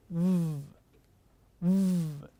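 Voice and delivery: a long buzzing v with the voice on; voiced v sound. Shown as a demonstration. Teacher demonstrating a voiced v for students. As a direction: buzz the v through the throat and nose. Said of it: "So practice this sound".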